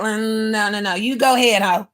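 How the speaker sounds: ordinary talk, nothing marked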